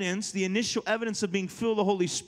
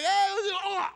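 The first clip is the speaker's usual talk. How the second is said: mock greeting; 11 semitones higher than usual. Acted reply to a sweaty hug.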